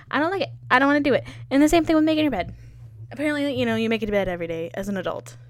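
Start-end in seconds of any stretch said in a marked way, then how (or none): 0.1-2.5 higher, louder whining about chores; whiny protest. Real, not acted.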